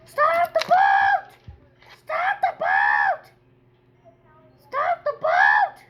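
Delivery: high, slow drawn-out yell; slow desperate shout. Pretend. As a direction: shout after the leaving boat. Left behind by the boat.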